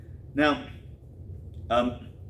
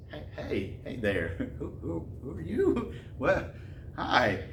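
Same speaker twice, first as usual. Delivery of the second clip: smitten soft greeting; 6 dB quieter than usual. Retold or imitated. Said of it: "his mood totally changes".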